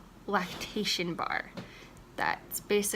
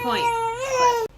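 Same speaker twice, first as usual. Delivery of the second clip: high-pitched strain; 13 semitones higher, 6 dB louder than usual. Real, not acted. Holding crying child.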